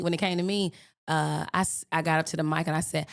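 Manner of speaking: ordinary talk, nothing marked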